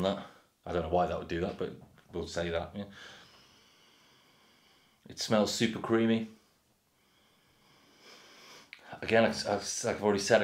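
glass held at lips; talking while sniffing drink; speaking into a glass. Real, not acted. Seen 0: Speaks while holding beer glass at nose and mouth, sniffing.

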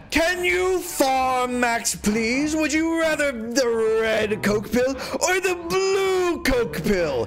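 high, slow, shouted mock question; mocking chat voice. Reading chat message in a voice.

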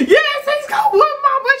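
very high, loud mock wail; imitated bawling. Crying daughter telling mama, exaggerated wailing. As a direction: blubber it like a scared kid, hammed up. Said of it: "So I'm crying".

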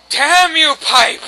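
loud, high outburst at the game; exasperated shout; angry cursing. Real, not acted. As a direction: curse it out loudly.

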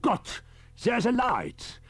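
put-on high, loud, alarmed old professor; alarmed cry. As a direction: panic as the glow spreads. Gas escaping, Riker panics.

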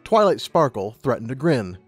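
ordinary delivery

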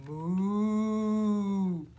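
long, loud moo; cow moo. Pretend. Teacher showing children what a cow says, mooing. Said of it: "what does the cow say".

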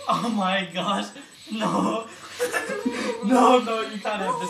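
laughing loud refusal. Real, not acted. Doctor refuses the patient, laughing loudly. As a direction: shout no through laughter.

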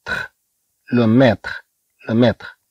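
تخ as t plus raspy kh; tre said tkh. Shown as a demonstration. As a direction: end ميتر in a crisp تخ sound. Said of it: "إذا طي آر أو تنطق".

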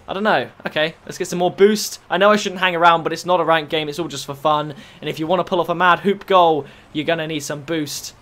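normal talking voice

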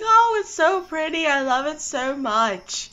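gushing. Retold or imitated. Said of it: "I've just been saying, like".

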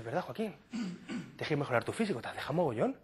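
hushed, confiding aside; talking to himself. Acted. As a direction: murmur it privately to the mirror.

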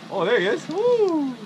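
ordinary delivery

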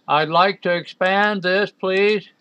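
loud, high, exaggerated politeness; overly courteous, pleading with the program; mock-polite demand. Mock-polite plea to software.

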